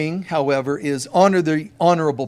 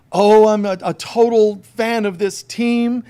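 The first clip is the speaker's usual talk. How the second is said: excited fan; 5 semitones higher, 6 dB louder than usual. Retold or imitated. Superfan guy gushing about team.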